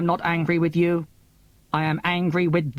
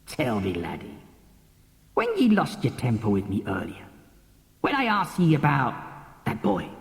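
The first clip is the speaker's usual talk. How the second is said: much lower, rough-edged sea captain brogue; old Scottish captain; 5 semitones lower than usual. Acted. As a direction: play the old sea dog, coaxing the lad. Old captain speaks to the boy.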